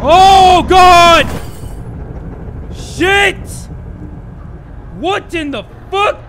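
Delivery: very loud, high shriek; real terror at a jump scare; frightened scream. Real, not acted.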